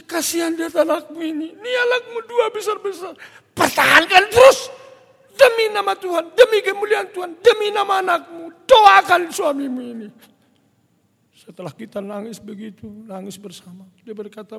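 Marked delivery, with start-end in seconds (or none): 0.0-10.1 high-pitched, loud wailing appeal; acted desperate begging; crying plea. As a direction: implore with mounting force, as if in tears. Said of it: "Itu saya nangis".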